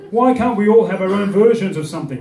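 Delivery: normal talking voice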